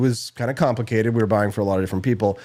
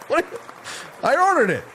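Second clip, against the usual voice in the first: mock baffled Paula. Paula's baffled reply in story.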